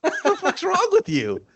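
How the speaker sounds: real laughing outburst of disgust; cracking up at his friend's gross line. Real, not acted. Friend's gross comment cracks him up.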